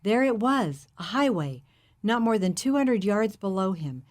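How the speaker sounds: plain reading voice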